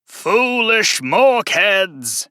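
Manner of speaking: high, slow, drawn-out demonic shriek; a summoned monster screeching at its summoners; shrieking fiend. Acted. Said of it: "I shriek the words".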